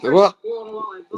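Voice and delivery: sudden high startled cry, then calmer; startled whoa. Real, not acted. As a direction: jump at a sudden noise. Keyboard suddenly makes strange noise.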